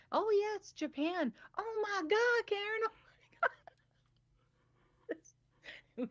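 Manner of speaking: very high, gushing squeal; imitating Diane. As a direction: swoon over every country on the ride. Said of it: "I just sat there like".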